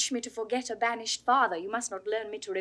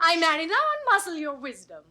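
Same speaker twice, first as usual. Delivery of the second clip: mock command; 5 semitones higher, 9 dB louder than usual.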